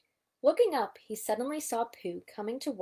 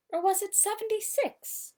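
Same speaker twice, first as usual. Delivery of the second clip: much higher odd voice; Owl musing; 11 semitones higher than usual. A narrator's reading. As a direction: ponder it in the old owl's voice. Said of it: "Al muttered".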